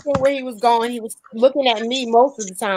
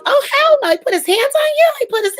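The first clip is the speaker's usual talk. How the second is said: very high, indignant mom voice; imitating outraged mother; 14 semitones higher, faster than usual. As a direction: shriek it as a tiny, furious mother. Voicing tiny mother's outraged reaction.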